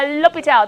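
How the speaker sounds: L'Hopital said loud and quick, loh-pee-tal; just say L'Hopital briskly; plain L'Hopital. Shown as a demonstration. Teacher to class, correcting the name, quick and loud. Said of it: "P S, uh".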